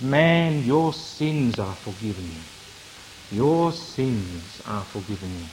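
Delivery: low, soft, slow and tender. Acted. Christ to the paralysed man, low soft tender forgiveness. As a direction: speak gently, like forgiving a friend. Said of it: "very familiarly and very tenderly".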